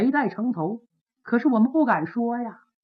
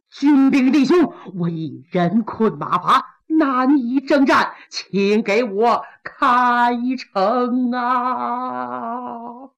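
very slow, drawn-out exhausted plea; weary plea; much slower than usual. Acted. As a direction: drag each phrase out like a spent warrior. Weary general begs soldiers.

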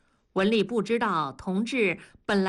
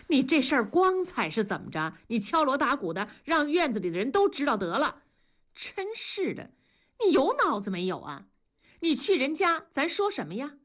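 higher, quick scolding reproach; wife blaming her husband, higher and faster; reproachful; 6 semitones higher than usual. A narrator's reading. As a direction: tear into him for shaming the family. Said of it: "文丽责怪地说".

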